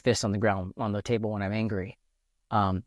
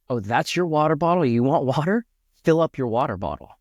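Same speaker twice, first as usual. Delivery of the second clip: high, bright nudging parent voice; playful dad prompting; 4 semitones higher, 4 dB louder than usual. Retold or imitated. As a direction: coax the kid to do it himself. Dad prompting kid, water bottle.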